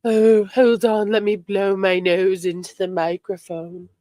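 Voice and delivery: lightly voiced whiny sick friend; Priscilla. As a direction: play a sick whiner blowing her nose. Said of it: "I feel like Priscilla coming on".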